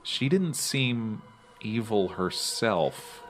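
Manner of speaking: normal talking voice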